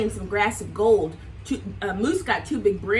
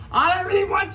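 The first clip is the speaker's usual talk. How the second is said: louder, higher grumpy roar; roaring Bear; 7 semitones higher, 5 dB louder, faster than usual. Acted. Bear roaring at Sheep, loud and grumpy. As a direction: growl it out at the sheep. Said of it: "roared at Sheep".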